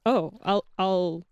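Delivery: ordinary delivery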